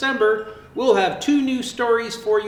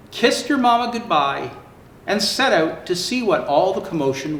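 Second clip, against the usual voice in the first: lively storybook narration; reading to children; 3 semitones higher than usual. Acted. Santa reading a zoo story to children, lively. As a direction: tell it brightly for little ones. Reads Fiona story aloud.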